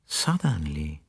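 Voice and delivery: Suddenly said softly and low, under the breath; a quiet, suspicious murmur; murmured under his breath. A narrator's reading. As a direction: mull over Suddenly to himself, hushed. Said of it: "He murmured under his breath".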